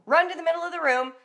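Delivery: ordinary delivery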